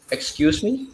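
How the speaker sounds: usual speaking voice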